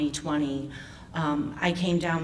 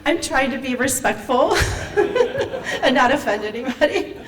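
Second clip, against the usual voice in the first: words through laughter, higher; laughing apology; 7 semitones higher, 4 dB louder than usual. Real, not acted. Speaker to the audience, excusing herself, laughing. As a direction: apologize while still laughing.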